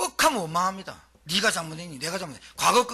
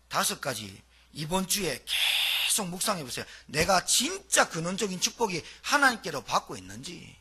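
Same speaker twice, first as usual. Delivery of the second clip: ordinary talk, nothing marked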